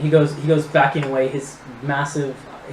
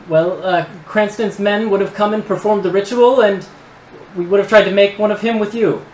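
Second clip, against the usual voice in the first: higher, louder, halting gnome voice; nervous gnome; 7 semitones higher, 7 dB louder than usual. Acted. Cowed gnome confessing his plans to the party, nervous. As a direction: stammer the confession in a raised pitch.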